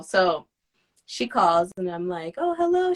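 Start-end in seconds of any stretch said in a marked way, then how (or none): 2.4-2.9 sweet phone hello. Retold or imitated.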